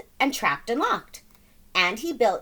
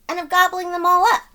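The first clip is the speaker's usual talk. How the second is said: higher, louder and fast; gleeful; 9 semitones higher, 7 dB louder, much faster than usual. A narrator's reading. Narrator relishing the gobbling, fast and gleeful. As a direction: gobble the words up greedily.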